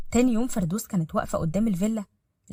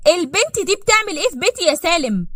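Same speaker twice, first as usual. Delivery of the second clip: high, loud indignant demand; outraged; 9 semitones higher, 7 dB louder than usual. Acted. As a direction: confront him in shock and outrage. Ehefrau entdeckt Rivalin im Haus.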